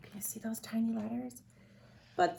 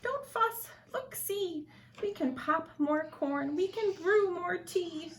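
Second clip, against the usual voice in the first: much higher tiny mouse voice; squeaky mouse; 8 semitones higher than usual. Acted. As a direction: squeak it out kindly. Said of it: "Mouse squeaks".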